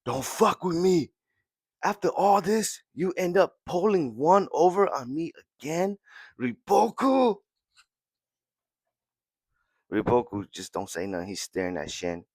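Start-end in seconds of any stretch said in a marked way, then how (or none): 0.0-7.4 high, strained through gritted teeth; seething anger. Retold or imitated. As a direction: spit it out at the enemy, voice pitched up. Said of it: "Shin grit his teeth and goes".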